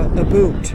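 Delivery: "About" said loudly as "a-BOOT"; Canadian "aboot". Shown as a demonstration. Showing companion how Canadians talk, "About" pronounced loudly as "aboot". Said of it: "they don't say the word about".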